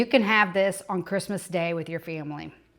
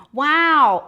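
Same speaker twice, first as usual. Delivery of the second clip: amazed wow; 8 semitones higher, 8 dB louder than usual. Real, not acted. Tasting the fudge.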